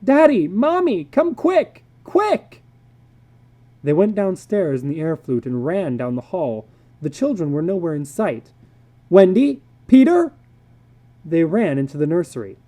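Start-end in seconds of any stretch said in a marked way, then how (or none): 0.0-2.6 put-on high, loud children's call. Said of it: "children calling". 9.1-10.3 high, loud urgent call. Acted. Parents calling children.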